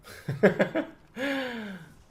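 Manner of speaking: high squealing laugh. Real, not acted. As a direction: burst into a high-pitched laugh. Laughs at maybe I did.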